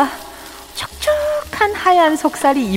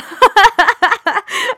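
high loud burst of laughter; 12 semitones higher, 5 dB louder than usual. Real, not acted. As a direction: laugh out at yourself. Laughing at own boast.

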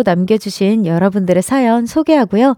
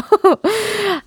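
embarrassed high giggle; 11 semitones higher than usual. Real, not acted. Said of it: "재미로만 받아주세요".

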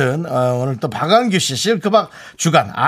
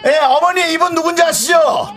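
high, projected event-host questioning; calling out to onlookers; 11 semitones higher than usual. Real, not acted. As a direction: call across to someone in the crowd. Calling out to adults in crowd. (구경꾼에게 높게 외쳐 묻기)

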